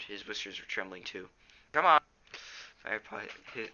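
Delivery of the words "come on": plain reading voice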